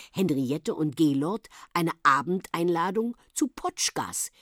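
ordinary delivery

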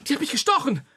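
a father turning hysterical after being stung; panicked. Acted. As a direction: lose all composure over the sting.

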